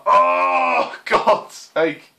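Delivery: loud groan breaking into laughter; laughing groan. Real, not acted. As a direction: groan loudly, then laugh it off. Groaning at the chandelier death.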